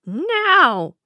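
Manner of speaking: high, loud gloating crone; old queen voice. A narrator's reading. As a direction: savor the triumph. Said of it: "thought the old woman to herself".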